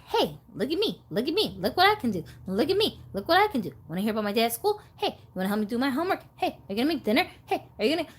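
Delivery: insistent mock nagging, one demand after another; pestering family members. Imitates family bombarding her with demands.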